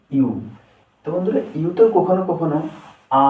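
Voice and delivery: ordinary talk, nothing marked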